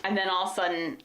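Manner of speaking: ordinary talk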